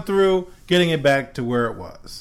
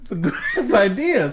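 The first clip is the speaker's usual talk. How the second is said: usual speaking voice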